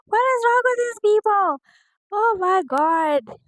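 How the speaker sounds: high exasperated. Real, not acted. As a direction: throw up your hands at the chaos. Reacting to reality show chaos.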